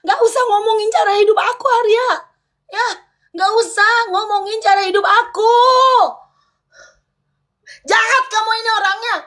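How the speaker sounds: high-pitched, insistent protest; upset protest. Real, not acted. Heated couple quarrel on livestream. (protes kesal dengan nada tinggi)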